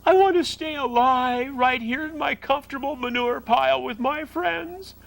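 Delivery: high wailing through tears; tearful screaming. Acted. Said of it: "screamed Wilbur".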